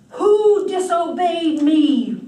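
furious god roaring at the household; thundering roar. Acted. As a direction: shake the walls with it. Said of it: "in a voice that shook the walls".

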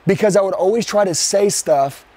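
ordinary delivery

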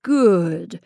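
enraged poacher snarling at his rival; snarled. Acted. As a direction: growl the word Good. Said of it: "snarled George".

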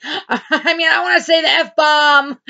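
half-laughing, half-shouting; laughing outburst. Real, not acted. Frustrated vlogger joking to viewers, laughing shout. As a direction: laugh it out loud.

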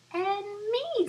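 high, loud little flea; a tiny flea chiming in. Acted. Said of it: "said the flea".

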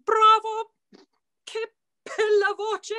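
high, loud mock-operatic exclamation; Countess's line. Singer imitating the Countess praising her voice. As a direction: toss it off high and grand like a diva. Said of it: "she said".

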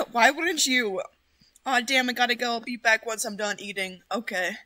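ordinary talk

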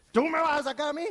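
mocking demon. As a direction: sneer at the disciple. Demonio se burla del discípulo. (burla desafiante del demonio, aguda y fuerte)

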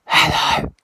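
sudden high, loud shout into the mic; yelling. Real, not acted. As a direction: blast a shouted hello. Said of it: "yell very loudly onto the mic".

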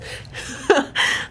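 short breathy giggle; real amused giggle; quick laugh. Real, not acted. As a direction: laugh it off lightly. Friend locked out, laughs.

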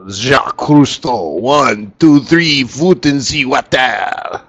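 bad mock French accent, lightly done; a hammed-up French accent, like Jacques Cousteau diving in; horrible French accent. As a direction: cheap French accent joke. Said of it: "My horrible French accent".

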